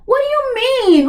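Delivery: high-pitched, incredulous challenge; incredulous outburst. Acted. Reviewer challenging the show's excuse for skipping accents, high-pitched incredulity. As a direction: shoot up high in exasperated disbelief.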